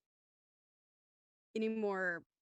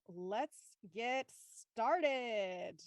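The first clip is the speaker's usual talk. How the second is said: very slow, stretched-out announcement; drawn-out kickoff; much slower than usual. Real, not acted. Host opening the show once all thumbs are up, drawn-out. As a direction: stretch every word right out.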